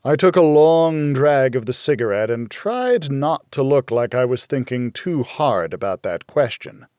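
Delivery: ordinary delivery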